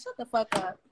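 ordinary delivery

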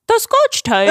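high mock British voice, "tape" as "type"; fake British accent. Answering Chloe's quiz on the tape, fake British accent. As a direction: slip into a badly faked British accent. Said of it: "You're not real British".

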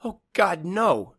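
a high, loud, despairing whine; whined. Acted. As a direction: let it crack out as the line goes dead. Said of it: "she whined".

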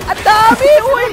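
high, loud outcry; real panicked exclamation; alarmed shout. Real, not acted. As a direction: yell it as the enemies pile in. Swarmed by enemies in game.

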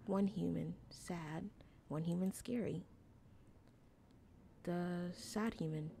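soft, halting opossum voice; wary little opossum. Acted. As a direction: speak quietly in small broken pieces.

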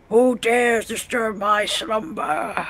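slow, ominous cave voice; Cave of Wonders voice. Retold or imitated. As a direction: intone it slowly like an ancient guardian. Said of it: "the Cave of Wonders from Aladdin".

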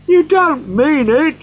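put-on high, loud, drawn-out boy's cry; incredulous cry. A narrator's reading. Boy cannot believe Scrooge means it, crying out. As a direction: cry out in disbelief like a young boy. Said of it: "cried the boy".

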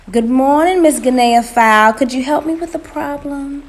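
high, loud, honeyed greeting; sugary calling out. A narrator's reading. Spider calling out to Miss Fowl, sugary. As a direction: call out with syrupy sweetness. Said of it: "called out in his sugary voice".

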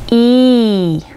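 "イー" stretched out as a long ee; drawn-out "イー"; long E vowel. Shown as a demonstration. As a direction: hold "イー" steadily. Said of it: "イーでできています".

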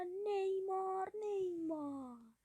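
rising excited call of the name. Real, not acted. Gamer calling his striker as the chance opens, excited. Commentating a goal build-up.

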